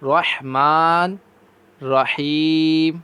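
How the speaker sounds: رحمان and رحیم with a clear breathy ح, very slow; slowly, with a distinct throaty ح. Shown as a demonstration. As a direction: stress the ح sound in رحمان رحیم. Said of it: "ان میں ہا کی آواز واضح کریں".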